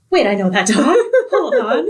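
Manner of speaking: laughing through a startled hold on; half laughing, half alarmed at the dog; breaking into laughs mid-quote. Retold or imitated. Neighbors recognize missing man's dog.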